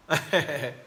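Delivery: short chuckle at a comment; amused chuckle. Real, not acted. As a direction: chuckle to yourself while reading.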